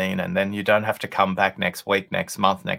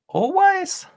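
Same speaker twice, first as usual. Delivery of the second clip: always echoed back much higher, as a probe; questioning echo; 5 dB louder than usual. Retold or imitated. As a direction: toss the word back high and curious. Playing back her word.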